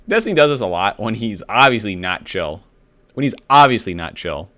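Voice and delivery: loud chiming in; loud comment. Real, not acted. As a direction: call it out over them. Bystander loudly commenting.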